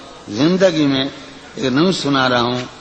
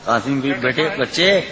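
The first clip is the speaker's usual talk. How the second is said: usual speaking voice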